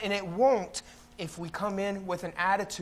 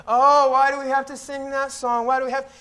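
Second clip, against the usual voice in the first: higher, louder mock whine; whining and complaining; 4 semitones higher, 5 dB louder than usual. Churchgoer whining about having to sing that song, mock complaining. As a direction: whine in exaggerated protest. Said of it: "People come in whining and complaining".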